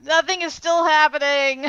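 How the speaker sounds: exasperated complaint. Retold or imitated. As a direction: wail that the boredom goes on. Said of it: "just 'cause I'm like".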